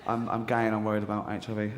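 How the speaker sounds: quiet worried patient; nervous patient. Retold or imitated. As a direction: mumble it like an embarrassed patient. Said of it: "patient at the GP".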